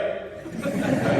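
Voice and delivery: high laugh breaking out after the punchline; real laughter bursting out. Real, not acted. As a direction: crack up at your own joke. Laughs at own joke's punchline.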